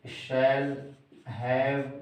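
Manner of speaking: ordinary talk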